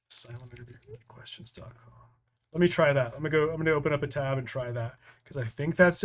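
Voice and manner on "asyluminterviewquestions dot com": very quiet, half-whispered to himself; talking to himself. Real, not acted. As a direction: murmur it under your breath while trying it. Trying the web address to himself.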